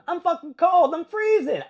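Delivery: high, loud comic whine; exasperated cry. Acted. Gym member complaining about cold showers, exasperated cry. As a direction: wail it like you can't take the cold.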